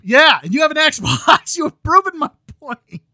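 gleeful real laughter while mocking the Xbox owner; laughing taunt. Real, not acted. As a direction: crow through the laugh at your friend.

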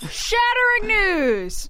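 high, slow, loud announcer; hammed-up newsreader; mock dramatic announcement. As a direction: announce it like breaking news.

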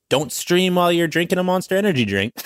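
high, nagging parent voice; lightly mocking a nagging mom; mock scolding parent.

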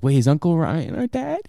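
put-on high excited kid voice; child voice. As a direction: play a confused child. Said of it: "be like".

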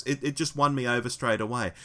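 ordinary talk

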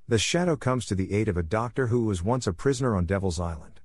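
ordinary delivery

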